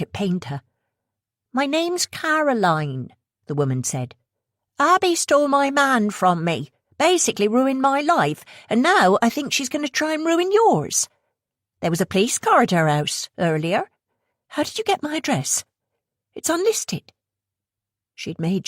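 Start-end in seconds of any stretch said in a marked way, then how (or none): 1.5-3.1 high, slow West Country introduction; Caroline's West Country accent. Acted. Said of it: "the accent heavy West Country". 4.8-11.1 high, louder West Country complaint; aggrieved West Country woman. Acted. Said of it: "the accent heavy West Country". 11.8-17.1 high, rising questions, wary and tense; alarmed young woman. Acted. Sophia voiced, alarmed.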